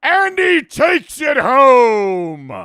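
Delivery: loud, high, slow announcer call; mock sports announcer. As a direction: belt it like a TV play-by-play man. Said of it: "they'd be like".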